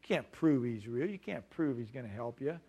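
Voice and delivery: low, hushed skeptical challenge; soft, dropped-pitch mock skepticism; doubting inner voice. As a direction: voice a nagging doubt inside someone's head. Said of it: "their mind would say".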